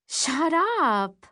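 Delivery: teasing, half-smiling protest; playful. Acted. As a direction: brush it off with a grin. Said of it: "but smile at the same time".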